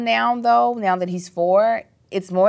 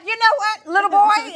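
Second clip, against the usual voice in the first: put-on high, sharp warning to her little boy; scolding mom; 14 semitones higher, 4 dB louder than usual. Mother warning her son at home, high mock-stern tone. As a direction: pull him up short with a mock-stern warning. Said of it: "And I'm like".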